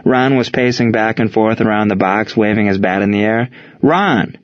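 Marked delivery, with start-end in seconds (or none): 3.8-4.4 sharp, higher-pitched call of a name; forceful but not shouted. A narrator's reading. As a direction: cut in firmly to get his attention. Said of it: "Buck shot out forcefully".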